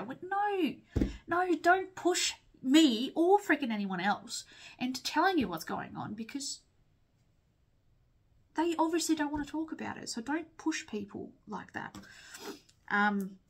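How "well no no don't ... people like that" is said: firm pushback. Real, not acted. Telling pushy friends to back off, exasperated and firm. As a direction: push back hard, fed up with prying.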